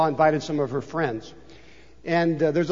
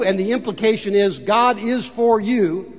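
ordinary delivery

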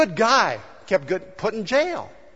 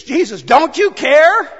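loud, high and slowed plea; desperate cry; 8 semitones higher, 8 dB louder, slower than usual. Retold or imitated. Disciples crying out to sleeping Jesus, loud and desperate. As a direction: cry out over the storm in panic. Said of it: "they said to Jesus".

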